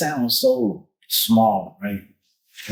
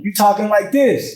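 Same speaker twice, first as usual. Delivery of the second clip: higher and louder, projecting to a far listener; calling across the room; 10 semitones higher, 4 dB louder than usual. Shown as a demonstration. Parent calls out to a kid across the room, loud and raised. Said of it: "when your kid is across the room".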